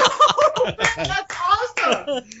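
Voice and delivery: very high, laughing squeal; slow high-pitched words through laughter; delighted laughter. Retold or imitated. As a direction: imitate someone cracking up with approval. Imitating co-star's laughing approval.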